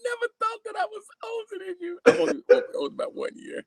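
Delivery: high voice laughing through words. Real, not acted. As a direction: laugh while protesting. Laughing about being older.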